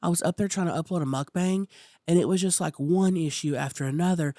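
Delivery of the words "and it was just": ordinary delivery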